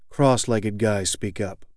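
ordinary delivery

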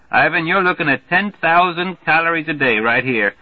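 put-on high, loud, hearty good-ol'-boy; brash Major Marvey. Marvey hollering a greeting to the newcomer, brash and hearty. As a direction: boom the welcome like a glad-hander.

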